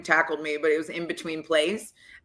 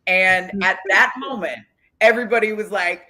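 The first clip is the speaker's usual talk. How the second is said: ordinary talk, nothing marked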